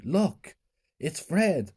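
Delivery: a small girl pointing him out, mildly voiced; little sister calling out. A narrator's reading. Little sister Annie spots Fred.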